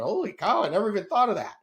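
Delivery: high, loud mock amazement; a podcast host imitating an amazed listener. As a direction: play a stunned listener having a revelation. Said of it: "they're going".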